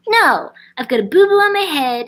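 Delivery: high whiny pet voice; dog's voice. Retold or imitated. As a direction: voice the dog as a whiny kid. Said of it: "He's like".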